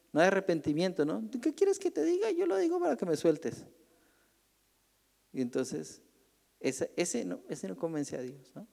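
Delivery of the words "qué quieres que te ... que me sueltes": put-on very high, petulant childish voice; whiny fake repentance. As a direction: whine like a kid saying sorry to get free. Sinner fake repenting to escape.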